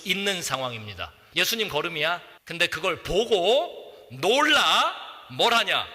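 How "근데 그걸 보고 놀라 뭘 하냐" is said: ordinary talk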